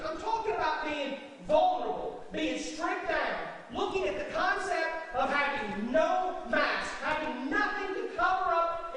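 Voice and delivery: loud, punched preaching with rising climax; preaching at full force. Acted. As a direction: build it up and hammer the point.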